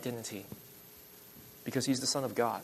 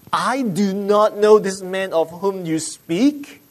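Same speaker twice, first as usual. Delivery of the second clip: slow, raised, rising into a question; incredulous repeat; 4 semitones higher, 4 dB louder, slower than usual. Acted. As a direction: echo the quote slowly in mock disbelief. Repeats the denial in disbelief.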